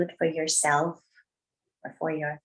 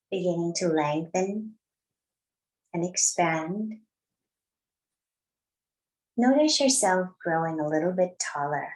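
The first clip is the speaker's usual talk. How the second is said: guided meditation. Acted. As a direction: ease the class into their breath, unhurried. Leads a seated breathing meditation.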